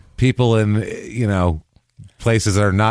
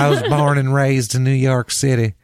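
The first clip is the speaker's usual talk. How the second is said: higher-pitched mock accent impression; Andie MacDowell; 5 semitones higher than usual. Imitating the actress claiming New York, put-on accent. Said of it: "Andie MacDowell".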